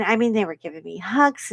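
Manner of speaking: normal talking voice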